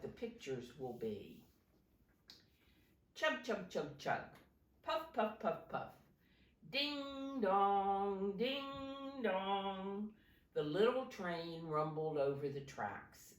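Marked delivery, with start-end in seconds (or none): 3.1-4.2 train chugging. Acted. Train sound read to children. 4.8-5.9 train puffing. Acted. Train sound read to children. 6.7-10.1 higher, sing-song ding dong; playful bell call for the kids; bell ringing. Acted. Train bell read to children.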